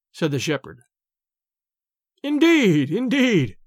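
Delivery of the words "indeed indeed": high-pitched, amused exclamation; amused to himself. A narrator's reading. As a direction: exclaim it high, privately tickled. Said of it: "and he laughed to himself".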